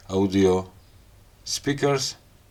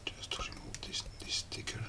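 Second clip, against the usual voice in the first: whisper; 17 dB quieter than usual. Real, not acted. Quietly peeling the sticker off.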